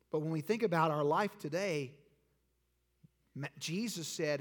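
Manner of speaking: ordinary delivery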